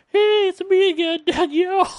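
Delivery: very high, loud falsetto woman's voice, laughing; female voice. Pretend. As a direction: squeal it as a woman named Danielle. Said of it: "shapeshift into a female".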